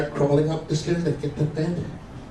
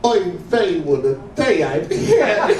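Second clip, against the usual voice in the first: higher, slowing comic lament; kvetching moan; 5 semitones higher, 4 dB louder, slower than usual. Acted. Wife told to moan in bed, kvetching instead. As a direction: moan it as a drawn-out complaint. Wife's mock moan as punchline.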